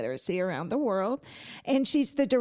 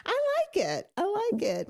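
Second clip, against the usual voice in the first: much higher, playful sing-song take; squeaky high mock voice; 11 semitones higher, slower than usual. Shown as a demonstration. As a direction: pitch it way up, teasing. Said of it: "sounded like Marge Simpson when I would go hoarse".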